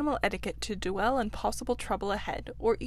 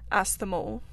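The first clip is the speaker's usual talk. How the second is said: plain reading voice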